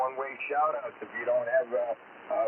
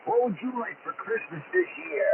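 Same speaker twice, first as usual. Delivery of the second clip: put-on higher, hearty Santa Claus with lots of oh boys; jolly Santa voice; 10 semitones higher than usual. Santa on the radio greeting kids, jolly and hearty. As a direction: play a cheerful Santa over the radio to excited kids.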